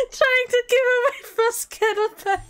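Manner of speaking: high voice, choked with laughter; helpless laughter. Real, not acted. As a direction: struggle to get it out through laughing. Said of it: "I'm laughing so hard".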